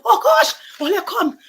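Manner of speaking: very high, loud frantic call; panicked shout. Acted. Frantic mother calls her daughter, very high panicked shout. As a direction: cry out in panic for the child.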